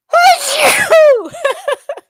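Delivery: loud high laugh after a sneeze; big high burst of laughter. Real, not acted.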